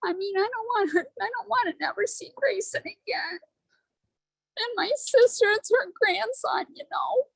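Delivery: barely holding back tears; fighting tears. Real, not acted. Woman telling her group about her nephew, near tears. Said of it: "I'm gonna try not to cry".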